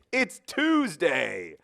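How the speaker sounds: "Tuesday" held long and high as mock news; mock grand announcement. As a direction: drag out "It's Tuesday" like a sarcastic proclamation. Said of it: "And I would be like".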